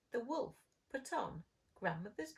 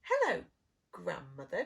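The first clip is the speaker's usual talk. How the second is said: wolf growl; 3 semitones lower, 8 dB louder than usual. Acted. Wolf at grandmother's door, loud wolfish greeting. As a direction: call it out, then drop into a growl. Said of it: "growled the wolf".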